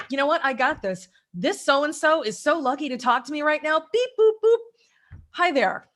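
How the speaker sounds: loud, high, brimming with confidence; confident pep talk. Retold or imitated. Salesperson psyching up for a call, cocky and loud. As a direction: pump yourself up before the call. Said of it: "it's an attitude shift".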